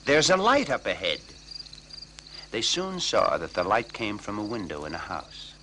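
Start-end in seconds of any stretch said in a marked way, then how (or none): 0.1-1.2 louder, higher call from a tree. Acted. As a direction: call down from the treetop. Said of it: "called down".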